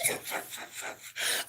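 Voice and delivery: soft, airy giggle; soft laugh. Real, not acted. Mother recalling her child's cussing, soft laughing. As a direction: giggle under your breath at the punchline.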